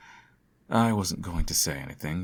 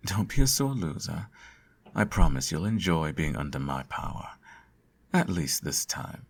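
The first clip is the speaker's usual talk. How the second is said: usual speaking voice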